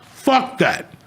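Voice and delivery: sharp high exclamation of disgust; high, fed-up outburst; exasperated outburst. Real, not acted. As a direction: spit it out, fed up. Ranting about hospital questions.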